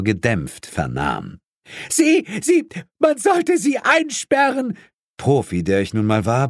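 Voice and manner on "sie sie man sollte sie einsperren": very high, stammering outrage; hysterical screeching. A narrator's reading. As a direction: screech at him, stumbling into it. Hysterical woman keeps screaming. (hysterisch kreischend, sehr hohe Stimme)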